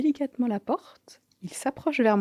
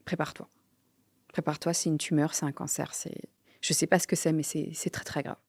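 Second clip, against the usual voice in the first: voix basse et retenue; préparer doucement son mari au pire; annonce grave; 2 semitones lower, 5 dB quieter than usual. Retold or imitated. (annonce grave, à voix basse)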